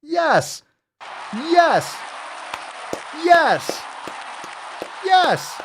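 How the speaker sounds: hammed-up victory cries; triumphant yell. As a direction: yell each yes way up high. Celebrates his big burp.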